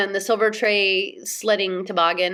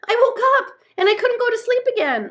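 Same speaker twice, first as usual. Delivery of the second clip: high, loud tale of woe; agitated high-pitched lament; overwrought complaint; 6 dB louder than usual. A narrator's reading. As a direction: blurt out your troubles, worked up. Moomin Troll pours out his woes.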